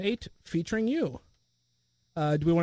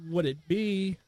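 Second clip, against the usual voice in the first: slow, halting, trailing off softer; typing aloud; 3 semitones lower, 4 dB quieter, slower than usual. Real, not acted. Host typing the question for the character, slow and halting. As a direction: say it slowly as you type it.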